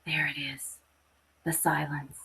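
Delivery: hushed whisper, barely voiced; soft whisper of relief. A narrator's reading. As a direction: breathe it so you don't wake the baby. Silence after baby stops crying.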